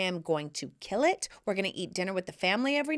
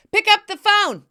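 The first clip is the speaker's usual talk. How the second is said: higher, louder, everything's paid for; carefree mom shout; 9 semitones higher, 10 dB louder than usual. Pretend. Mom to hungry kids at the resort, a loud carefree shout. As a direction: boom it out like a jackpot winner.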